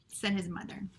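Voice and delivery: usual speaking voice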